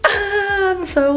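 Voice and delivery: high, distressed upset; real dismay over a character death. Real, not acted. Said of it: "upset right now".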